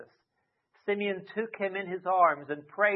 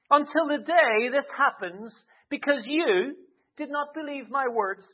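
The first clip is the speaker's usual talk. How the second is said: higher, louder haughty angel voice; snooty angel; 6 semitones higher, 6 dB louder than usual. Retold or imitated. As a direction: deliver it like a lofty, stern angel. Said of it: "I can imagine a bit of a snooty voice, but maybe not".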